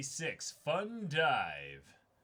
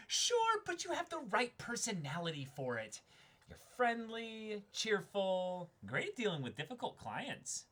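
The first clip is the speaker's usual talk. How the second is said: cheery boss voice; 5 semitones higher, 6 dB louder than usual. Acted. Boss character praises new hire.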